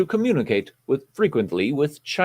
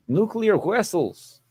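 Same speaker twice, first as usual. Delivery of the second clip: slow, deliberate mock Russian "Nuclear vessels", with a w for the v, falling firmly at the end; mock Russian accent; 5 semitones higher, slower than usual. Host delivers the requested phrase, hammed-up Russian accent. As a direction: land it slowly like a movie Russian. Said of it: "you have to say nuclear vessels".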